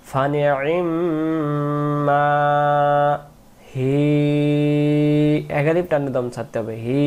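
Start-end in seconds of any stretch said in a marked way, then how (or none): 0.1-5.5 slow melodic tajweed chanting; slow chanted Quranic recitation. Shown as a demonstration. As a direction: draw the vowels out in a sung recitation.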